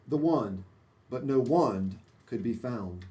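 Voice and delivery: usual speaking voice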